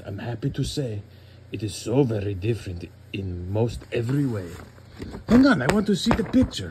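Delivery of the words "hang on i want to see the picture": high, loud strained plea; strained protest. Real, not acted. Dad fighting his daughter to see the picture, high and loud. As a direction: push through her grip to see the page.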